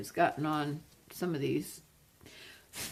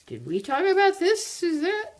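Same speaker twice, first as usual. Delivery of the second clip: surprised; 12 semitones higher, 4 dB louder than usual. Real, not acted. Surprised question to friend.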